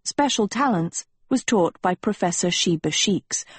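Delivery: usual speaking voice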